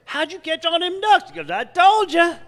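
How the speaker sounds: amazed question, smug reply; squeaky character voices; Boudreaux and Thibodeau. Acted.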